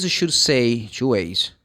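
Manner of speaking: ordinary talk, nothing marked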